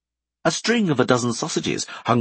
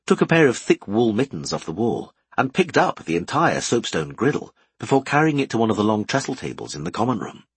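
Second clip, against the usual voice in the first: ordinary delivery, nothing marked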